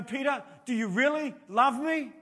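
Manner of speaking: high, loud pleading question as Jesus; Jesus asks Peter if he loves him, earnest; Jesus asking Peter. Acted. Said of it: "then he says to Peter".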